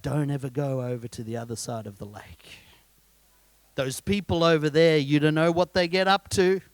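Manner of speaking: lightly voiced mother warning her boy; mother cautioning her son about the other side, lightly voiced; mum's warning. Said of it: "mums warned their little boys".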